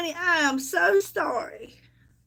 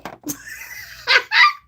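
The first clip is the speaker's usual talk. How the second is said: shrieking laugh. Real, not acted. Big laugh after boast.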